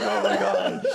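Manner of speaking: high, loud imitation of panic; hammed-up panicked outcry; mock freak-out. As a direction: act out a brother losing it. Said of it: "His brother's freaking out".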